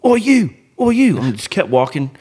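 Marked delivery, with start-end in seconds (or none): 0.0-1.2 higher, louder call of oi; teen calling out. Retold or imitated. Teen kids calling out to him, loud high oi. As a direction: shout it across the car park. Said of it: "They're like".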